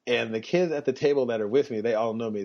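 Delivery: normal talking voice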